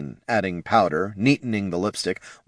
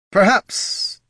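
coaxing perhaps; 13 semitones higher, 4 dB louder than usual. A narrator's reading. Champion coaxing the queen.

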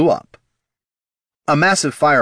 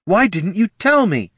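high, loud accusation; wounded, heated question; hurt outrage; 12 semitones higher, 5 dB louder than usual. Acted. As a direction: demand an answer, voice pitched way up. Hurt Loruna confronts wizard.

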